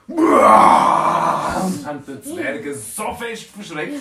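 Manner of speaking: loud, slow, drawn-out scream; roaring to scare the dwarf; scary shout. Acted. (langgezogener lauter Schrei)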